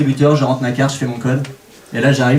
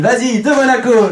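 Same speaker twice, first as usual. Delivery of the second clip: proud cheer; 8 semitones higher than usual. Retold or imitated. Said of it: "j'ai dit à ma copine".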